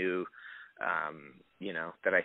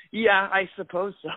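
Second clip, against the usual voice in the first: high, louder, hedging reply; awkward half-agreement; 10 semitones higher, 5 dB louder than usual. Retold or imitated. Actor retelling his answer to fans, awkwardly conceding. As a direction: play up a hesitant yes, pitched way up.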